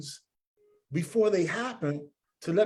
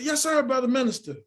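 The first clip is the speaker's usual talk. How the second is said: higher-pitched, eager acknowledgement; eager reply; 7 semitones higher than usual. Retold or imitated. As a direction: answer your superior promptly, pitched up. Eager reply to minister retold.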